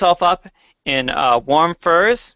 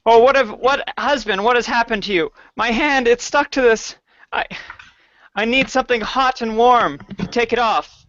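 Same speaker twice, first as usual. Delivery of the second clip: higher, worried woman's voice; alarmed wife voice; 7 semitones higher than usual. Acted. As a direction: fret over a hurt husband.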